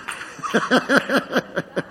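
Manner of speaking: quiet airy chuckle at his own joke; soft breathy laugh. Real, not acted. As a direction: let a soft laugh slip out.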